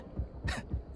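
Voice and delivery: short breathy mocking laugh; scornful laugh. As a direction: laugh off the accusation. Egon mocks being called by name.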